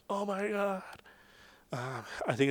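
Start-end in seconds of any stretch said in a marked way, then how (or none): 0.1-1.0 sudden high frightened cry, imitated; scared gasp. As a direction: squeak it out in panic as the kid slips. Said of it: "You know, like".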